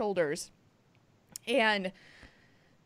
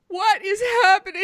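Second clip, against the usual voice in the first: laughing; 6 semitones higher, 7 dB louder than usual. Retold or imitated. Repeated, now laughing.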